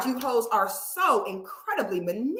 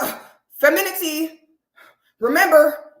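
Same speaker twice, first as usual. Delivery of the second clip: mocking mimic; 3 semitones higher, 6 dB louder than usual. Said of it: "they be like".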